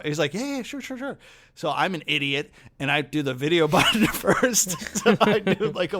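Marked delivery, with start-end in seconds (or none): 0.3-1.2 high, soft imitation of an old man agreeing; agreeable old man. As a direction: happily agree in a light old man's voice. Old man voiced in story.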